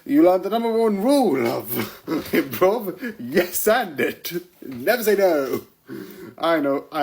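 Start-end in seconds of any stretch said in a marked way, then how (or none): 0.1-5.6 mock teacher. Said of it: "It was like".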